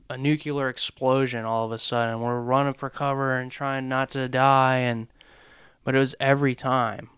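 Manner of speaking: ordinary talk, nothing marked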